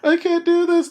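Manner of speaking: high, loud, frantic pleading; panicked daughter. Retold or imitated. Retelling the film, daughter panicking in the safe room. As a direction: mimic a terrified girl losing it. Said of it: "the daughter is like".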